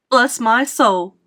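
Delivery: loud, slow exclamation of amazement; astonished cry. A narrator's reading. Fire chief sees a small boy up there, crying out amazed. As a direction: cry out, stretching each word in astonishment. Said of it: "cried the head of the fire department".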